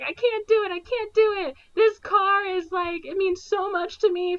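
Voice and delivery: very high, whiny mock crying; mock panic. Ben Stiller frets about the car, high mock whimper. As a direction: whimper like a scared kid.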